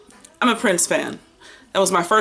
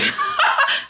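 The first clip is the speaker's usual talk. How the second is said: a burst of high-pitched laughter; squealing laugh; high giggle. Real, not acted.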